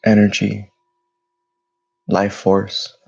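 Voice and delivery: measured meditation voice with long pauses between phrases; guided meditation. Real, not acted. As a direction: guide listeners through their breathing, phrase by phrase. Leads a breathing meditation.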